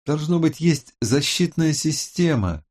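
Роза. A narrator's reading. Tech expert announces a protection system, high and deliberate. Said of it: "объявила Роза".

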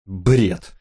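ordinary delivery

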